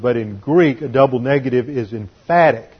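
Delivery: usual speaking voice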